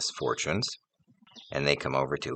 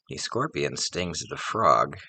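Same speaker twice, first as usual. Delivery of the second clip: usual speaking voice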